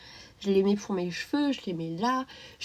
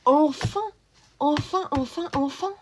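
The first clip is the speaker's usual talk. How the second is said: higher, louder repeated cheer; excited; 6 semitones higher, 6 dB louder, slower than usual. Real, not acted. Streamer cheering a viewer's news, excited. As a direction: cheer it out, each one rising.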